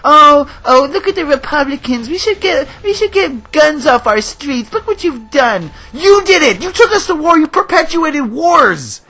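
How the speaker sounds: high, whiny mock complaining; mocking the president. Mocking the president blaming Republicans, high whiny voice. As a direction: sneer like a preachy president whining. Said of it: "our president is complaining".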